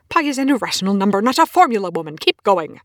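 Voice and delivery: loud, fast, scolding yell; exasperated shout. Acted. Scientist pushing his colleague on, loud exasperated scolding. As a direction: push her hard to keep going.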